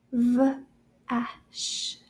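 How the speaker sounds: "V-A-C-H" as three separate sounds: v, a, sh; sounded out. Shown as a demonstration. Teacher breaking vache down for children, "V-A-C-H" sound by sound. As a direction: sound out "V-A-C-H" slowly, gaps between the sounds.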